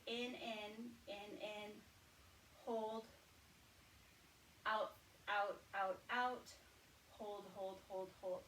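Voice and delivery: slow counted breathing cues, drawn out. Shown as a demonstration. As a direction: count the breath for them gently. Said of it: "I'm going to count out the four".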